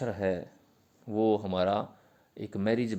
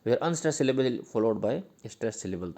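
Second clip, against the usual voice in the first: slow dictation; much slower than usual. Shown as a demonstration. Dictating English definition to students.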